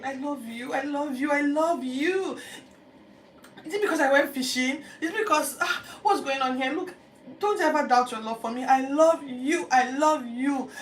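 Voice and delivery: high, loud, desperate protest; urgent pleading. Retold or imitated. Peter answering Jesus the third time, urgent pleading. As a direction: beg him to believe you. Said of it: "the urgency with which Peter responded".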